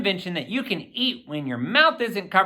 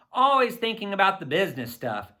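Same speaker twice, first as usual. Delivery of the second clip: usual speaking voice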